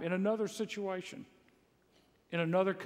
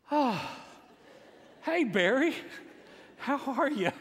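nervous fake cheer; 7 semitones higher than usual. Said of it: "I'm breaking into a cold sweat".

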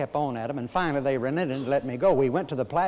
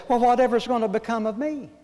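high, louder, quick plaintive wail; Scarlett O'Hara; 8 semitones higher, 5 dB louder, faster than usual. Acted. Preacher quotes a movie heroine at the door, high, loud plea. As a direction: play a distraught movie heroine, pitched well up. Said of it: "Scarlett O'Hara says".

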